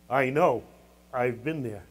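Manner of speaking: low, hushed admission; quiet confiding. Real, not acted. Preacher confiding in parents from experience, quiet and low. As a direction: drop low and soft, as one who knows.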